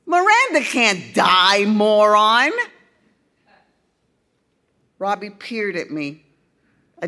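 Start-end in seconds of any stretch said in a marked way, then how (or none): 0.1-2.5 loud, scornful brush-off; scoffing. A narrator's reading. Girl scoffs loudly.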